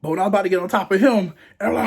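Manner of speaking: normal talking voice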